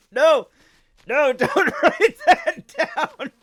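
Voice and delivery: loud, high protest through real laughter; helpless laughing shout. Real, not acted. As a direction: beg them not to, cracking up. Protests while laughing hard.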